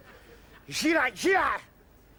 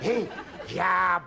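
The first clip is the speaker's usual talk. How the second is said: furious shout. Acted. Drunk Scot bawling abuse at a passing soldier. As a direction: bellow the abuse at him.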